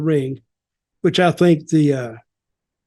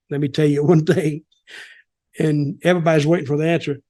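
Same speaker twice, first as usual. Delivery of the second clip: usual speaking voice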